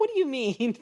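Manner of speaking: high-pitched, baffled mock reaction; retold disbelief. Co-host replaying their confusion at the odd remark, mock-baffled. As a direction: blurt it in exaggerated confusion. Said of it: "I was like".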